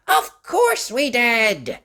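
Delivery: the twins answering their mother; children answering. A narrator's reading. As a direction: answer brightly together. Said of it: "said Topsy and Tim".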